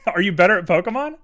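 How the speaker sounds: fast, loud, laughing question. Real, not acted. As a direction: ask it through a laugh. Laughs on the line.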